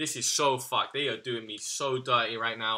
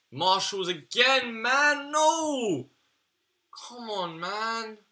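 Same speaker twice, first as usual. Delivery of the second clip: much higher, louder, slow disbelieving cry; outraged wail; 10 semitones higher, 6 dB louder, slower than usual. Real, not acted. Reacting to a repeat unlock, outraged wail.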